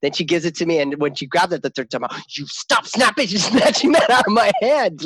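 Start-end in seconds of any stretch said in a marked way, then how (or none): 2.3-5.0 high, exasperated scolding with a laugh; mock fed-up parent, breaking into laughter; retold snapping. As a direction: act out losing patience, getting louder. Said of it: "I finally snapped".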